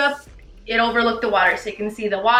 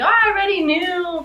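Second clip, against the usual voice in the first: mom's voice; 6 semitones higher than usual. Retold or imitated. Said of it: "she ends up telling me".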